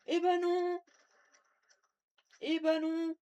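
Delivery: high, loud taunting no; gloating shout. Pretend. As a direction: gloat triumphantly. Gloating at victim.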